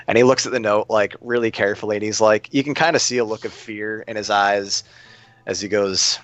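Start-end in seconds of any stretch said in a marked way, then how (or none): none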